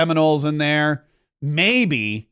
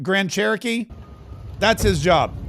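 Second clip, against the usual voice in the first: usual speaking voice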